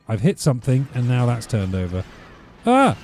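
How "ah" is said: sudden high, loud shriek; real yelp of alarm, loud enough to overload the mic; short piercing "Ah!"; startled shriek. Real, not acted. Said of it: "overloaded the microphone shrieking then".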